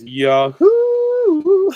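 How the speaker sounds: high shrieking Yahoo yell; Yahoo shout imitation. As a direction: shriek it like the movie moment. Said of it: "he goes".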